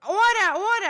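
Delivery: put-on high, loud New York accent call for order. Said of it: "the thickest New York accent".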